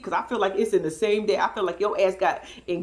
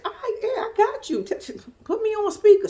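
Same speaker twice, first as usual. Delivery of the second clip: mock obliging phone voice, high; imitated fiancé; 10 semitones higher than usual. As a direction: put on his voice, pitched well up.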